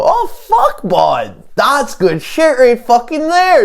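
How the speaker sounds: very high joke voice, slowing at the end; supposed real voice. Acted. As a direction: squeal it out as a mock "real voice". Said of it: "that's what my real voice sounds like".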